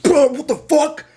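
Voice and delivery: high, loud drawn-out yelp; retold shock, stretched out and shouted; incredulous outburst. Rider to the man who hit him, incredulous.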